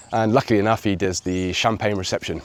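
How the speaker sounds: ordinary delivery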